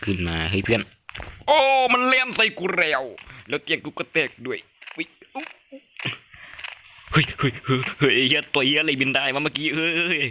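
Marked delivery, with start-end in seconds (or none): 1.5-10.2 very high, panicky gamer yelling; shocked outcry. Real, not acted. Player suddenly attacked and bed broken, shocked outcry. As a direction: burst out in high-pitched alarm and disbelief. Attacked, sees something fly.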